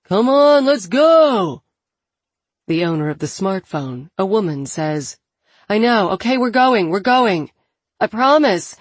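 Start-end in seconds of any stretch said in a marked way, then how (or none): 0.0-1.6 high, loud call from off camera; urgent shout. A narrator's reading. 5.7-8.8 raised, higher voice answering back; hurried reassurance. A narrator's reading. Woman answers a shout to leave.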